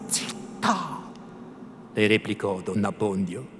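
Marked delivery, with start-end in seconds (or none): 0.1-1.1 whispered, drawn-out order to be silent; urgent shush. A narrator's reading. Priest hushing the women in dangerous territory, urgent whisper. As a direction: cut her off in an alarmed whisper.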